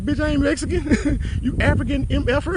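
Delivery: high retold outburst broken by laughter; laughing mock outrage. Friend objecting to being called Mexican, high laughing mock outrage. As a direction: mimic an indignant friend while cracking up.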